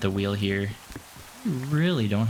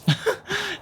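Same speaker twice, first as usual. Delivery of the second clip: high laugh. Real, not acted. Laughs at a single iron.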